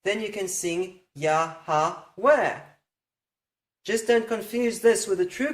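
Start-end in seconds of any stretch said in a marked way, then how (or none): 1.2-2.6 Yahweh slowly as three beats, ya-ha-weh; three parts. Shown as a demonstration. Teacher fitting the name to music, Yahweh in three parts. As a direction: stretch Yahweh with an extra ha in the middle. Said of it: "what if you need three parts".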